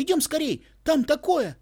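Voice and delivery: high, loud and urgent; excited. A narrator's reading. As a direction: hurry him, breathless with news. Excited boy calling grandpa. (взволнованно, громко)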